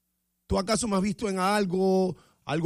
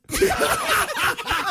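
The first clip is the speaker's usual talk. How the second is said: burst of laughter; 5 dB louder than usual. Real, not acted. Bursts out laughing after scene.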